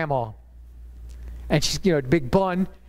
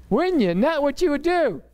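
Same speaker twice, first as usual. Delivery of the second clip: lightly voiced child, pitched higher; higher, lightly put-on daughter voice; imitated daughter's voice; 7 semitones higher than usual. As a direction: needle him like a little daughter questioning Daddy. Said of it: "doing his daughter's voice".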